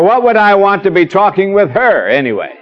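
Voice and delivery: high mock wife, laughing; imitating a wife brushing off the question; dismissive wife. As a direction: scoff at the idea.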